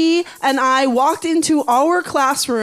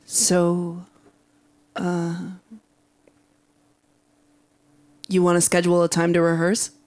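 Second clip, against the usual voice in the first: lightly voiced boy, lower and halting; hesitate, then get the question out quickly; low, understated awkward ask with long pauses; awkward young man; 4 semitones lower than usual. A narrator's reading. Boy's awkward line, voiced by narrator.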